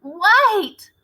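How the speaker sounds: high loud shout. Acted. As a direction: shout it to stop everyone. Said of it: "she shouted again".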